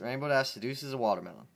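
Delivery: ordinary delivery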